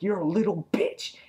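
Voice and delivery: high-pitched retold insult; going off. Alan insulting Andrea in the retold quarrel, going off. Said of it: "he was going off".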